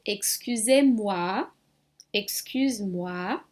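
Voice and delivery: "excusez-moi" said slowly, moi as mwa; slow French model. Shown as a demonstration. Teacher slowly models "excusez-moi" as example. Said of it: "palavrinhas moi, por exemplo".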